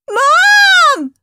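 high, loud panicked scream. Acted. Peter screaming for Mum in panic. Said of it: "screamed Peter".

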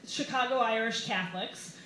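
ordinary talk

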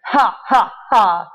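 separate, spoken monster "ha"s; deliberate storybook villain laugh. A narrator's reading. Monster laughing down at the circle of kids, deliberately. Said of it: "looked down at them and laughed".

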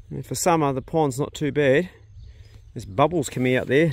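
ordinary talk

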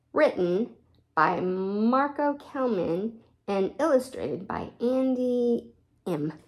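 plain reading voice

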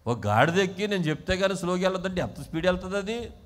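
ordinary talk, nothing marked

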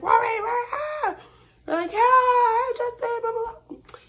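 high excited mock squeal at Rory; fangirl squeal. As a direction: squeal like a fan at a concert. Fans screaming at Rory.

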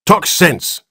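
high, curt rebuke; doctor snaps at the flustered father; sharp. A narrator's reading. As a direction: cut him off sharply. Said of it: "said Doctor Keene sharply".

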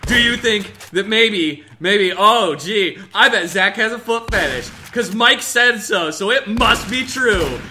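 high, slow mocking singsong; mock the gullible friend; mocking sarcasm.

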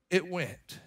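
ordinary delivery

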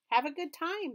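cheerful call from a distance. A narrator's reading. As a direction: call it across the room. Beverly calling after them.

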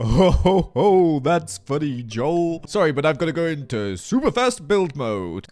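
badly faked accent, loud jolly ho-ho; Scar. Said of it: "I can't do your accent very well, Scar".

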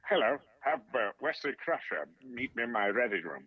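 stately British starship captain impression; put-on English captain's voice; Patrick Stewart. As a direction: give an order like a British stage actor. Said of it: "Patrick Stewart for Patrick Stewart".

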